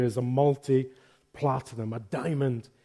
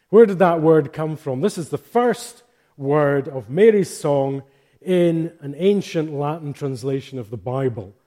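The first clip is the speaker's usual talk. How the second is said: ordinary talk, nothing marked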